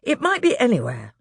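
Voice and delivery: put-on puzzled older boy. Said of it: "said Peter".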